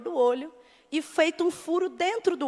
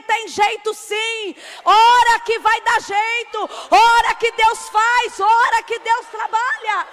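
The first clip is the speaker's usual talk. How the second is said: preaching at full force; 5 semitones higher, 8 dB louder than usual. Acted. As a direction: hammer every phrase home to the church.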